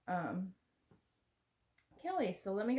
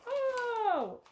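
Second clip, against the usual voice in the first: surprised; 13 semitones higher, 5 dB louder than usual. Real, not acted. Unwrapping a gift, finds coasters.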